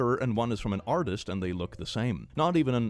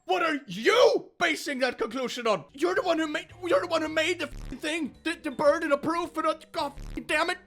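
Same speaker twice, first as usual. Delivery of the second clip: exasperated shout. Real, not acted. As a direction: lose it at the commenter. Escalating exasperation.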